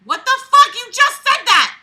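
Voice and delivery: high, loud disbelieving yell; exasperated shout. Real, not acted. Reader shouting at the writer's repetition, exasperated. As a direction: blow up at the clumsy writing.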